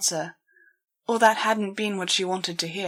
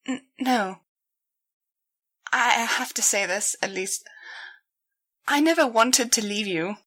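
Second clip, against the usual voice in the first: put-on strained young mare; 3 semitones higher than usual. A narrator's reading. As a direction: force the words out through the hurt. Daphne forces words out.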